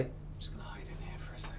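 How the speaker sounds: hushed whisper, hiding; sneaky whispered aside. Acted. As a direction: whisper so nobody inside hears. Hiding by the bathroom door.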